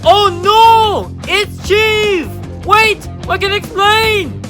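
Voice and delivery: high, frantic villain in alarm; panicked cry. Acted. Villain panics as Chief arrives.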